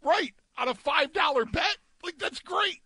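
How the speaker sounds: high, excited disbelief; stunned excitement. Real, not acted. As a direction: voice cracking up high in shock. Reacting to huge payout.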